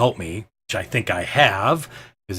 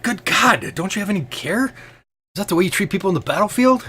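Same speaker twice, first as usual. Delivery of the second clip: high-pitched, appalled reproach; indignant scolding; 10 semitones higher than usual. Acted. Killraven to Merrick over battlefield care, indignant scolding. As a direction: scold them in raised, outraged pitch.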